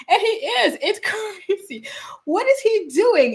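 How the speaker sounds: high, laughing, stretched out; laughing disbelief. Real, not acted. Mocking the man to her viewers, laughing high. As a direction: laugh through the words.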